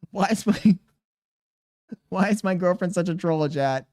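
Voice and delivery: laughing. Real, not acted. Laughing while repeating.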